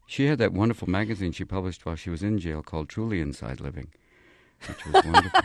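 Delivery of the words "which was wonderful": 1 mock high exclamation. Martha Stewart impression.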